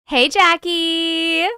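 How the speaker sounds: high, long-stretched hello; drawn-out greeting. Real, not acted. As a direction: sing out the name slowly. Greeting an arriving viewer.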